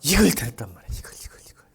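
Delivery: hushed, breathy whisper. Real, not acted. Preacher whispering what Paul heard, hushed. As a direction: lean in and whisper it.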